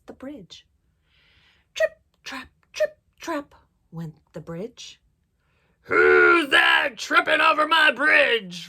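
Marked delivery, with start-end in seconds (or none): 1.8-3.5 clipped, higher trip-trap steps; hoofbeats. A narrator's reading. Storyteller sounding the youngest goat's steps, higher and light. 5.8-8.7 loud, slow, drawn-out roar; troll's roar. A narrator's reading. Troll challenging the goat on his bridge, slow roar. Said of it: "roared the troll".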